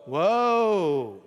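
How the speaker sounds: Woe called out loud and long. Shown as a demonstration. Preacher leads the congregation in saying Woe, loud and long. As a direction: call out Woe for the room to echo. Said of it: "Say that word with me".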